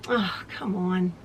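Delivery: low voiced exasperated mutter; muttered frustration. Real, not acted. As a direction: sigh it out under your breath. Thread tangles again.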